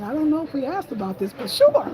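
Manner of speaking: put-on startled kid jumping at the offer; delighted disbelief; eager surprise. Surprised teen answering mom's offer.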